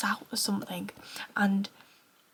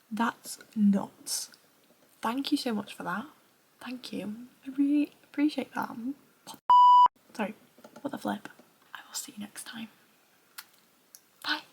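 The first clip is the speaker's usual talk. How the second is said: usual speaking voice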